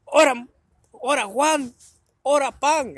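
high, loud teasing shout; joking shout. Retold or imitated. Dad calling his friend Juan to tease the pot seller, high and loud. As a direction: holler to your buddy to join the joke.

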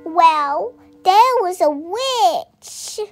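usual speaking voice

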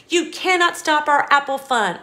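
ordinary delivery, nothing marked

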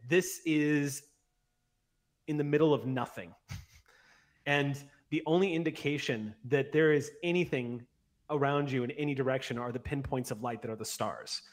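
ordinary talk, nothing marked

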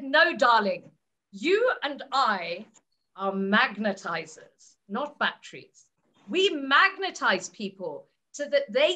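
low, slow, unhurried mentor voice; Alec's deep voice. Retold or imitated. Alec correcting his admirer, low slow and measured. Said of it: "in that deep voice".

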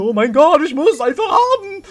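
high, loud, giddy craving; excited. Acted. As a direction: squeal it like she must have it. Craving the seaweed.

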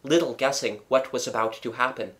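usual speaking voice

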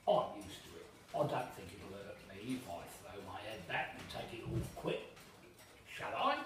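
slow, loud wheedling waiter; sly waiter. Acted. Waiter wheedling the boy's ale away, sly. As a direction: talk the boy out of his ale.

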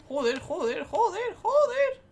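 escalating cursing. Real, not acted. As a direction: build from mutter to shout. Sees many enemies.

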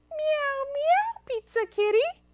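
very high meowing cat voice; kitty greeting. Acted. Child greeting a pizza kitty, in high cat voice. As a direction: mew it high like a kitten. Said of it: "What if it's a pizza kitty?".

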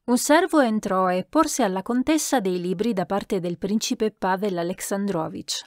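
ordinary delivery, nothing marked

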